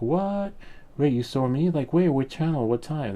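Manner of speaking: high-pitched, incredulous questioning; acted astonishment, pitch leaping up; stunned surprise. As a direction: replay being caught off guard, firing questions. His stunned reaction to friends.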